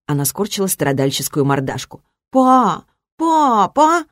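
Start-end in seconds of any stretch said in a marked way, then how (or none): 2.3-4.0 put-on high childish wheedle; pleading daughter. A narrator's reading. Suffering girl begging her father to flee, pleading. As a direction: call for daddy, pleading high.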